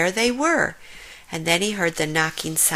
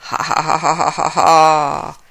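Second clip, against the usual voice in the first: creepy cackle; 3 semitones lower, 6 dB louder than usual. A narrator's reading. Hyena cackling unseen in the dark, creepy. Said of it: "a creepy cackling could be heard".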